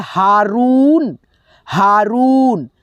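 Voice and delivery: Haroon said very slowly, the long ऊ held; drawn-out demo. Shown as a demonstration. Teacher to students, showing the long ऊ sound, slowly drawn out. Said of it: "र में बड़ा ऊ की मात्रा का उच्चारण बड़ा हो".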